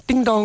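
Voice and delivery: high sing-song ding-dong; imitated doorbell. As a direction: chime like a doorbell. (tiruan bunyi bel rumah bernada tinggi)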